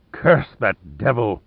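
slow, drawn-out snarl; snarled curse. A narrator's reading. Commander fooled by his quarry, snarling. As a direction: curse him slowly, with a snarl. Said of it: "Kung Chong snarled".

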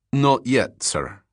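put-on Russian-accented polite reply, falling at the end; brief courteous answer with a Russian accent; heavy Russian accent. A narrator's reading. Lieutenant answering the detective, heavy Russian accent. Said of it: "in a heavy Russian accent".

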